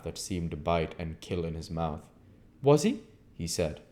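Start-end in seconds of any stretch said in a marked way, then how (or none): 2.6-3.0 raised, clipped-sounding stranger; a lightly voiced curt stranger; a hint of the stranger; stranger's voice. A narrator's reading. Visitor voiced.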